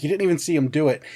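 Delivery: usual speaking voice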